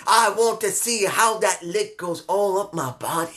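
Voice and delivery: a hint of a gospel preacher, swooning; breathy mock revival swoon, mostly in own voice; mock church preacher. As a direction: ham it up like he's at church, lightly. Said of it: "He sounded like he went to church".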